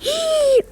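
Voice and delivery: sharp high startled gasp. Real, not acted. Girl hearing mum arrive, startled gasp. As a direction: gasp as if caught.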